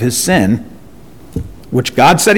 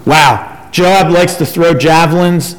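ordinary delivery

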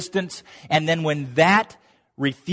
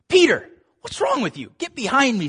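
exasperated rebuke; 8 semitones higher than usual. Retold or imitated. Jesus then rebukes Peter.

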